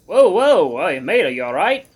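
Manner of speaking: loud, alarmed check on a friend; concerned call. A narrator's reading. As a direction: call out with concern, loudest on the whoas. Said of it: "Almond's concerned voice behind me".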